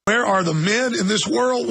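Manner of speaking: ordinary talk, nothing marked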